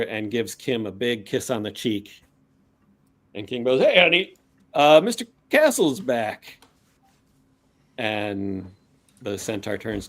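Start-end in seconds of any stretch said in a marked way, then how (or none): 3.8-6.6 higher, louder happy hail; cheerful greeting. Acted. Kim tells centaur wife of visitor, cheerfully excited. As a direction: share good news with your sweetheart.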